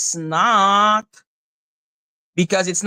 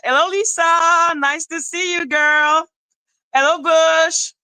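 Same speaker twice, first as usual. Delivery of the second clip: singsong greeting; 6 semitones higher than usual. Real, not acted. Streamer welcoming arriving viewers by name, singsong greeting. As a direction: call it out high, holding the last vowel.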